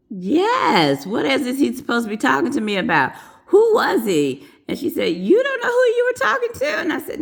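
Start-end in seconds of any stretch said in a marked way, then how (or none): 0.1-4.4 higher, louder, retold exasperated questioning; mock indignation. Nurse answering her manager back, indignant. 5.2-6.8 very high, quick disbelieving question; acted incredulity. Manager questioning the nurse, incredulous.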